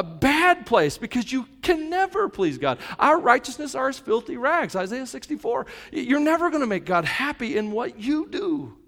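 high, loud and fervent preaching; urgent sermon at full volume; full-force preaching. Acted. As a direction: preach it out to the room.

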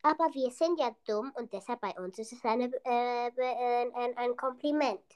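fast, tumbling words with stumbles; rushed genie talk. Pretend. Genie gabbling fast to his cousin about compliments. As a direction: hurry through it, tripping on the words. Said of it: "Wie sprichst du eigentlich?".

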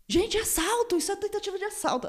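very high-pitched alarmed cry; acted alarm. Victim telling neighbours it is a robbery, high-pitched acted alarm. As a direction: raise the alarm in a high mock panic.

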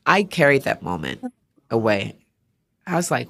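normal talking voice

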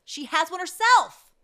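high, loud outraged yell; indignant shout. Real, not acted. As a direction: shout it in disbelief. Outraged repeat, much louder.

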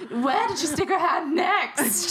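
high, slow laughing question. Real, not acted. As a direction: ask through giggles.

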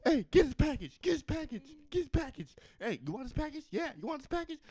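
high, mocking taunt; taunting clerk. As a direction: jeer it up high like a petty clerk.

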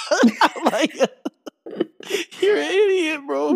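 high-pitched laughing through words. Real, not acted. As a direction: crack up mid-sentence. Laughing at friend's joke.

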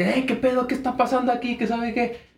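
high voiced startled grandma; grandma catching teens. Grandma confronting drunk teens, high startled voice.

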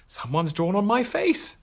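squeaky horrified protest; upset cucumber. Acted. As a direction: complain like an indignant cucumber. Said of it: "cried Cucumber".

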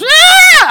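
put-on piercing child's shriek, very high and loud; child's scream. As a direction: let out an ear-piercing kid's scream. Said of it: "And he screams".

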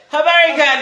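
greeting called out high and loud; hearty pretend Kwanzaa greeting. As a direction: sing it out like a festive hello. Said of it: "I'm walking in the work like that".

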